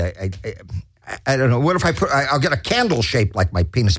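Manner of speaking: ordinary talk, nothing marked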